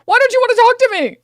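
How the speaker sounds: high, loud, fast whining mockery; mock the rich guy begging to be heard, shrill and loud; mocking whiny plea. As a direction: whine it like a snubbed big shot. Mocking the guest's hurt plea.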